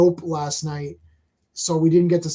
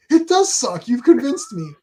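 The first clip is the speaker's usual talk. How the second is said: higher, louder, hammed-up sarcasm; sarcastic mock agreement; 6 semitones higher, 5 dB louder than usual. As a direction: play it as fake sudden conviction.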